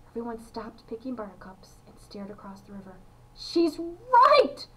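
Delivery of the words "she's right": high, loud burst of agreement; excited exclamation. A narrator's reading. Charlie confirming the sighting to Grandpa, excitedly. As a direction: call it out in amazement.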